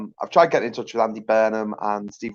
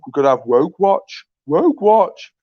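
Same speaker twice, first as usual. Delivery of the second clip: high, loud mocking slogan; mocking announcement; 5 semitones higher, 6 dB louder, slower than usual. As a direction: sneer it like a show title. Mocking Andrew Neil's idea.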